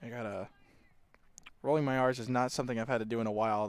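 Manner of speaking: normal talking voice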